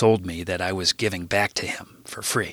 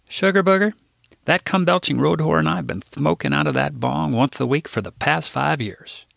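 Philip voice; 6 semitones higher than usual. Acted.

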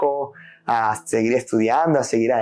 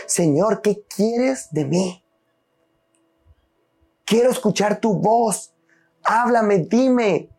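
pleading prayer; 8 semitones higher, much slower than usual. Retold or imitated. Retold desperate prayer to God.

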